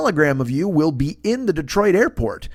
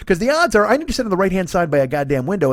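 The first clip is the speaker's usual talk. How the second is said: ordinary delivery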